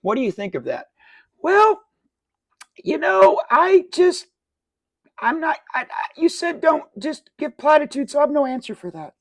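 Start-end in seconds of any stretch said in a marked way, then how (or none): 1.4-9.1 high, loud put-on voice, stammering; hammed-up high-pitched evasive politician; mock flustered politician. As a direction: stammer through a non-answer in falsetto. Acts out politician's flustered answer.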